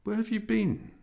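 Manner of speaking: mother's voice. A narrator's reading. Mother questions late son.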